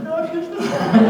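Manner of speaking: high, pleading laughing prayer; desperate prayer. Retold or imitated. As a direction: beg God in mock panic. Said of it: "I started praying".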